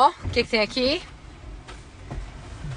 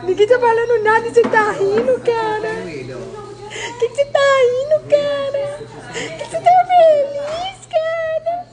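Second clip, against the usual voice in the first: rising mock exasperation. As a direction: pitch climbing higher with each repeat. Teasing a laughing friend, escalating.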